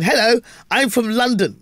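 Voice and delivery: high, slow posh English greeting; over-enunciate it in posh English; posh London accent. Shown as a demonstration. On stage in America introducing himself, posh accent. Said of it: "I'm very posh".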